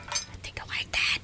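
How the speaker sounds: a quick whispered confession; shy whisper. Acted. Scientist admitting she wants her guest to stay, shy whisper.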